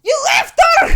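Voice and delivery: high, loud outraged yell; scandalised shout; shocked shout. Real, not acted. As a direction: yell it in disbelief. Reacting to the comic.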